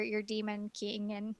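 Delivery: usual speaking voice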